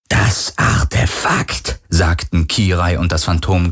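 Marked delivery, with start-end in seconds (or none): 0.1-1.8 slow eerie whispered words; ghostly whisper. A narrator's reading. Phantom and Kirai demand the artifact in unison, whispered. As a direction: breathe it like a ghost.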